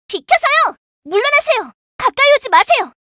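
high, loud orders to stand back; commanding firefighter shout; urgent shouted commands. Acted. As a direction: yell to push the crowd back.

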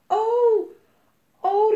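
high, loud startled wail; alarmed cry. A narrator's reading. Mama suddenly realises something, crying out in alarm. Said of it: "riep mama verschrikt".

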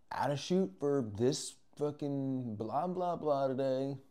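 exaggerated, stretched-out mimicry; mocking braggart. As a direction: draw it out to mock a show-off poster. Mocks people bragging about shoots.

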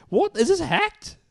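jolted by the strange posts; shocked disbelief. Real, not acted. Friend sees random photos, asks in shock.

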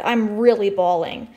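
usual speaking voice

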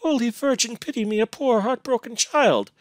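high-pitched pleading prayer; anguished plea for mercy; heartbroken moan. A narrator's reading. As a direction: plead pitifully, voice lifted high. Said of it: "with a low moan out of her heart".

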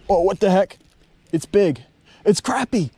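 high-pitched thrilled exclamations; real excitement at a surprise fish; excited. Real, not acted. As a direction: gush over the catch, voice jumping up. Excited catch of big crappie.

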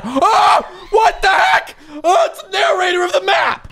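high startled yell with laughter; shocked outburst, laughing; surprised shout. Real, not acted. Surprise at narrator reveal.

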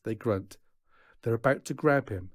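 usual speaking voice

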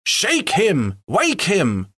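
high mild mock shout; impatient shout. A narrator's reading. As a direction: hurry them up. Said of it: "shouted the others impatiently".

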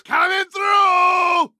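high, slow shouted warning; drawn-out shout. Acted. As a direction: yell it while charging. Charging through.

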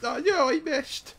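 high, slow Irish wail of dismay; Irish accent. Pretend. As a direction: cry out in a put-on Irish accent. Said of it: "I couldn't hold that accent forever".

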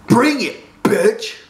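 high, loud, excited taunt; wildly eager challenge; wild excitement. A narrator's reading. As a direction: egg him on to fight, pitch jumping up. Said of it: "said his friend with wild excitement".

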